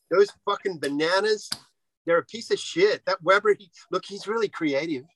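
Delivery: hammed-up American accent trashing the board. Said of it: "if it's an American accent".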